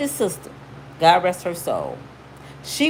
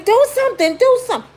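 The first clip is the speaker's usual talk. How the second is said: high, loud frantic begging; repeated desperate plea, much higher; panicked plea; 4 dB louder, faster than usual. Retold or imitated. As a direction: beg her to act, frantic. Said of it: "And I'm like".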